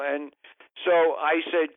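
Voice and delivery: ordinary talk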